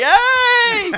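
very high, loud hammed-up hey; over-eager greeting. Greeting someone he had banter with on set, over-eager. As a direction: squeal hello to a new best mate.

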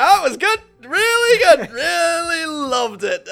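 high, loud gushing, slow at the end; high squeaky fake delight; fake enthusiasm. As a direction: overdo the polite praise. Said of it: "I'm like".

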